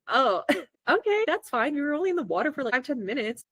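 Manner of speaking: high, easygoing reassurance; breezy acceptance. Retold or imitated. Retelling her breezy reply to mistake.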